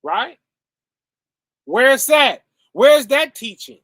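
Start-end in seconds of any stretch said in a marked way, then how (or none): 1.6-3.8 calling it out, louder and higher; loud challenge. Real, not acted. Preacher demanding to the congregation, raised loud challenge. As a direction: demand an answer from the room.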